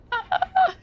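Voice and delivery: a sharp, high burst of disbelieving laughter; incredulous laugh. Retold or imitated. To her cheating ex, an incredulous high laugh. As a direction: laugh in disbelief at his nerve. Retelling her reaction to him.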